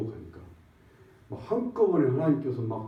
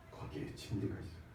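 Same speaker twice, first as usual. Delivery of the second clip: whispered; 16 dB quieter than usual. Real, not acted. Sermon closing, hushed.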